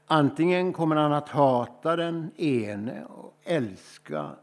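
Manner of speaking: slow, weighty reading of the gospel words; solemn Bible reading, unhurried; slow scripture reading. Acted. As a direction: read it out slowly as holy text. Reading the gospel passage aloud.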